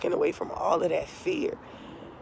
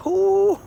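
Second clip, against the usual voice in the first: high loud laughing ooh through tears; tearful laugh; 14 semitones higher, 9 dB louder than usual. Real, not acted. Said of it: "these are tears of joy".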